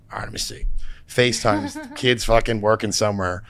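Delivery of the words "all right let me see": soft whispered reluctant agreement. Retold or imitated. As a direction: whisper it like a wary dad. Wary dad agrees quietly.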